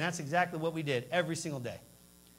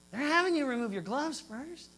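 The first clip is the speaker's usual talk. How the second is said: incredulous question; 6 semitones higher than usual. Retold or imitated. Repeated outraged question.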